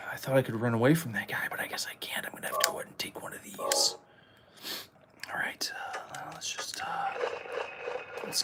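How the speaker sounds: hushed fast whisper. Real, not acted. Gamer whispering while hiding from the guy. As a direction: whisper so the monster won't hear. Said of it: "hiding, whispering to viewers".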